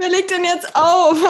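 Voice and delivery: high laughing question. Real, not acted. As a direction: squeal it out laughing. Laughing at the hang-up game.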